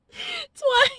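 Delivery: Twice. jumped up very high in mock disbelief; exasperated squeak. Shown as a demonstration. Vlogger repeating that her camera died, high squeak. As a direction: squeak out Twice. like you can't believe it. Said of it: "My camera died twice".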